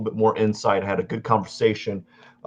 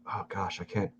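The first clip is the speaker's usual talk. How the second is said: groggy mumble; 3 semitones lower, 13 dB quieter than usual. Retold or imitated. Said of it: "'cause I'm like".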